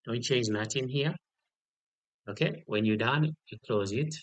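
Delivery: ordinary talk, nothing marked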